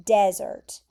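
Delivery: "Desert" stressed on the first syllable, DEZ-ert; desert, the dry place. Shown as a demonstration. Teacher naming a hot dry place, stress on DEZ in "Desert". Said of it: "And you pronounce it".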